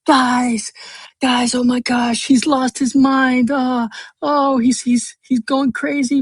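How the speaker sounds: very high-pitched put-on voice of alarm; hammed-up, high mock distress; mock panicked tattletale. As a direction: mimic a friend frantically warning the group. Mimics friend panicking in group chat.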